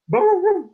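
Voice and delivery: high, loud yapping bark; proving bark. Acted. Coyote barking at the doorman to prove he's a dog. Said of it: "I bark like a dog".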